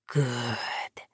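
low soft satisfied whisper. Acted. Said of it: "that devil whispered".

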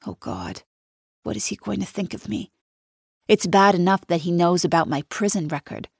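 ordinary delivery

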